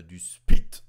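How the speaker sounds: slow, loud drawn-out spit; German accent. Shown as a demonstration. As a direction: stretch spit with German weight. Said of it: "comme c'est allemand". (accent allemand appuyé)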